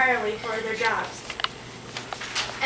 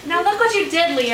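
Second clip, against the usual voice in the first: scolding; 5 semitones higher, 5 dB louder than usual. Acted. Scolds Leo after the quarrel.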